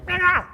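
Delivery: a sudden, very high and loud yowl like a stepped-on cat; cat screech. Retold or imitated. Speaker mimicking a cat whose tail is stepped on, piercing screech. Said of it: "it's gonna go".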